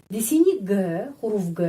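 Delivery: usual speaking voice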